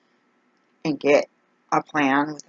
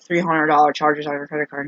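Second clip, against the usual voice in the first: ordinary talk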